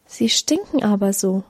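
usual speaking voice

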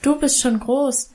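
a touch of envious complaint; plaintive. A narrator's reading. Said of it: "klagte ich".

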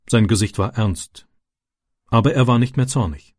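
usual speaking voice

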